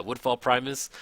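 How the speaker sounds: ordinary talk, nothing marked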